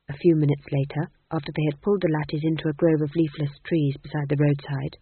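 ordinary delivery, nothing marked

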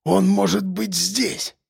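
slow, soft hoarse warning. A narrator's reading. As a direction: rasp the warning out slowly. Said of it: "прохрипел он".

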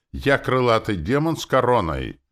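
high, loud boast; menacing growl. A narrator's reading. Demoness asserting her rank, menacing. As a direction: proclaim it with menace. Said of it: "грозно прорычала Настёна".

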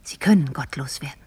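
solemn hushed confirmation; grave whisper. Acted. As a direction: breathe the answer darkly. Grave reply to the prince.

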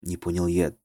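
ordinary delivery

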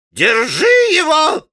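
a high, slow shriek; high piercing old man's shriek. A narrator's reading. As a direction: screech it out at the intruder. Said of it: "завизжал Фёдор Павлович".

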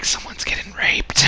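hushed, unvoiced whisper. Friend recalls the alley, a spooked whisper. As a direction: whisper it like a creepy secret. Retold thought in dark alley.

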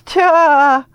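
high, loud, sharp cry; mocking exclamation. A narrator's reading. The boy scoffs at the wrong name, loud and high. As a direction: bark it out in amused disbelief.

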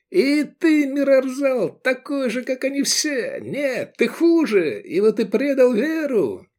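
very high, strained accusation; accusing yell. A narrator's reading. Zealot accuses his friend of betrayal, yelling. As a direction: wail the betrayal at him in a high voice. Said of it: "вопил Тураб".

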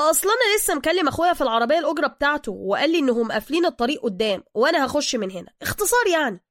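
fast start, high and loud; glib driver explaining. Acted. Driver explains the side road to Bruce, high and glib. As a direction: rattle off a loud excuse.

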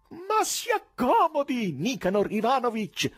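high, loud, slow fawning call; unabashed yell. A narrator's reading. Korofiev offers the chairman a chair, high fawning yell. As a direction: shout the welcome, fawning and shameless. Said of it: "urlava quello, senza il minimo imbarazzo".

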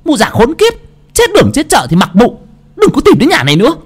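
high, loud cursing; cursing tirade. A narrator's reading. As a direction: hurl abuse after her. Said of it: "miệng xa xả chửi".

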